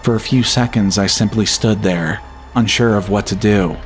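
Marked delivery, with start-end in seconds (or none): none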